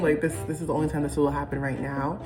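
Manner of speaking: ordinary delivery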